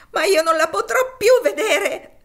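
high, loud, anguished sobbing; sobbing exclamation. Acted. Topsy cries that she'll never see Eva again, sobbing loudly. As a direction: wail it through tears. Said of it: "I suoi singhiozzi raddoppiarono".

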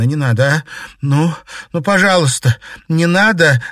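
high loud anguished begging; desperate pleading. Acted. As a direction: beg him not to die. Begging over dying Igor.

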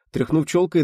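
ordinary delivery, nothing marked

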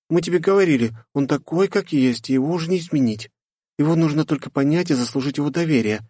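put-on high, patient explaining girl. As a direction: reason kindly with a friend. Katya consoling Lifa. (высокий девичий голос)

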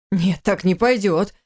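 plain reading voice

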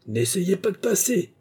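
high, shouted threat; barring the way; madman howling. Acted. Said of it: "hurla-t-il".

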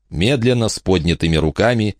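usual speaking voice

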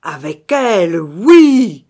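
very loud, building drawn-out shout; desperate cry. A narrator's reading. Phantom lunges to seize the woman, screaming defiantly. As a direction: scream it with a supreme effort. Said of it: "s'écria Kostaki en faisant un effort suprême".

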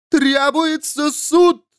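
very high, slow proclamation; screamed demand. A narrator's reading. Nun raises her arms and demands a trial, screaming. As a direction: cry out for judgement. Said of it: "закричала она".

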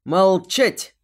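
loud, forceful bark; barked order. A narrator's reading. Amir silencing the heroine's cries, loud bark. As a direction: roar it as a command to silence. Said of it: "рявкнул Амир".

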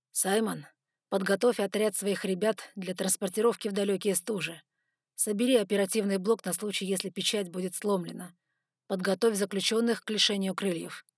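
usual speaking voice

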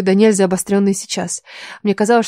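usual speaking voice

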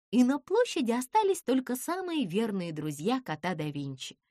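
plain reading voice

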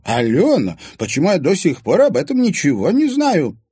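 high, loud indignant cry; indignant surprise. A narrator's reading. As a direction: demand why she was never told. Indignant friend.

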